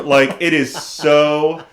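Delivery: ordinary talk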